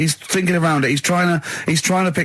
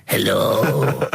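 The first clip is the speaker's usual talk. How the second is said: talking yogurt voice; 2 semitones lower than usual. Retold or imitated. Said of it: "a little scenario, an embellishment".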